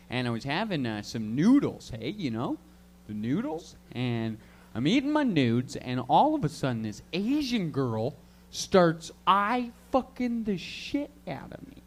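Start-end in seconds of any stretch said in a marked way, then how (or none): none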